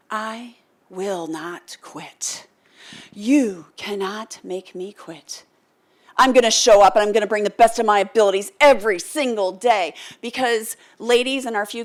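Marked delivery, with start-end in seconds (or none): 0.1-5.4 each word set down slowly and firmly; slow defiant vow. Retold or imitated. To the commander, slow firm refusal to quit. 6.2-9.9 louder, driving promise; firm loud vow. Retold or imitated. To the commander, a loud determined promise.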